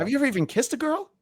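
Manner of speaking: very high, fast, rising to the end; mock high-pitched question. Comic acting out his sketch moment, high mock questioning. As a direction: squeak it out as a put-on question. Acted comeback after "And I go.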